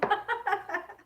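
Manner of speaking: high, real burst of laughter. Real, not acted. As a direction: crack up at your own joke. Laughs at her own joke. (carcajada aguda)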